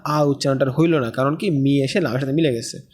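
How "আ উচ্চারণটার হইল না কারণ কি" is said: ordinary talk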